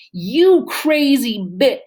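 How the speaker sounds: loud, high, slow furious cry cut off; furious yell. Acted. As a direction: scream the insult at her in rage. Remy grabs her, yelling.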